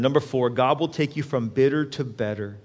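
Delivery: ordinary talk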